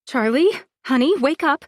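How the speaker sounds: building from frantic calls to a high, loud scream; panicked screaming. Acted. Mother screaming at her unconscious daughter, building panic. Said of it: "I'm screaming over and over".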